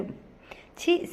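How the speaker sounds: a breathy, high, soft "ఛీ" of disgust; disgusted chee. Acted. Pujitha recoiling from Manoj, soft breathy disgust. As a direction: spit out "ఛీ" under the breath in revulsion.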